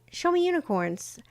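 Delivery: just ask once and let go; asking the universe lightly; light request. Shown as a demonstration. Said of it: "I went".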